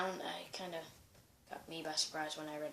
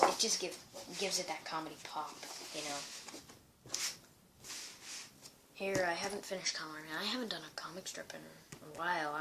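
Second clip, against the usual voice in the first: normal talking voice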